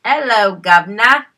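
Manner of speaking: put-on Cockney accent, loud and playful; mock Cockney accent. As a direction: belt out a jokey Cockney greeting. Echoes the mock British greeting.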